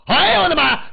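high, loud shriek of fright; terrified cry. Acted. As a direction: cry out like a startled crowd. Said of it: "这下可把大伙儿吓死了".

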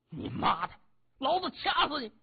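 high-pitched strangling threat; enraged brute on the attack; furious thug. A narrator's reading. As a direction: snarl a curse, then jump up high in rage. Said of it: "那个大汉气急了".

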